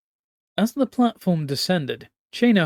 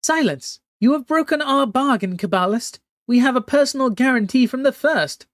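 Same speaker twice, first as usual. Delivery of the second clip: high, loud imperious screech; 9 semitones higher, 5 dB louder than usual. Acted. Empress Lawan scolding the Kabbalist through the mirror, high loud screech. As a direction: shriek the rebuke down at the mirror. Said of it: "she screeched".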